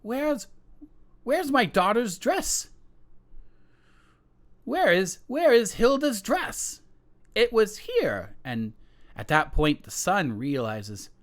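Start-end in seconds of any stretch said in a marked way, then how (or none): 0.0-8.3 high, frantic queen voice, rising louder; queen shrieking for her lost dress. Acted. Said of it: "The queen shrieks out".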